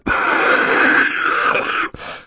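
long, loud hacking cough; acted coughing fit. Mister Poe breaks off his sentence, coughing hard.

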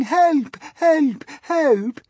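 imitating grandmother's calls, high; mock cries for help. Miss Spider imitating grandmother's cries, high and wailing. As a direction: plead like a trapped old spider. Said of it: "hear her calling to us, saying".

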